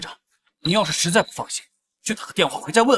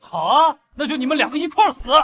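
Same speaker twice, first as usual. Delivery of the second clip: higher, quickening angry threat; vindictive outburst; 5 semitones higher than usual. Acted. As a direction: lash out at both of them. Rival turns on the defiant couple.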